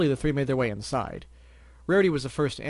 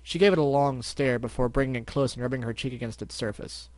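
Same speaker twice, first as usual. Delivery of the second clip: ordinary delivery, nothing marked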